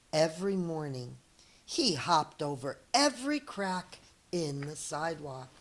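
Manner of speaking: lively read-aloud storyteller voice; story narration. Acted. Teacher reading a picture book to her students, lively storytelling. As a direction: read it to young kids, expressive and clear.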